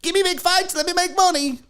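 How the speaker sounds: a hint of a dopey influencer bragging; mocking YouTuber boxer. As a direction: lightly send up a clueless YouTuber. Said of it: "is like".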